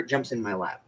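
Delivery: usual speaking voice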